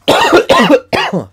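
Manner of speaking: loud choking cough; harsh cough. Real, not acted. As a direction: hack out a cough. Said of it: "I'm still having trouble breathing".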